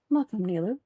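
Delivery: ordinary talk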